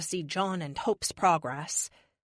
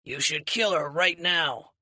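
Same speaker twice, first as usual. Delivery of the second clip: slow, menacing mercenary; gruff merc. A narrator's reading. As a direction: growl it out slowly. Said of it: "Hand Cannon says".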